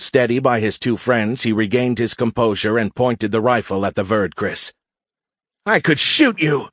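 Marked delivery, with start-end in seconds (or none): 5.6-6.7 high, agitated cadet voice; agitated threat. A narrator's reading. Senior cadet aims the rifle at the warrior, agitated.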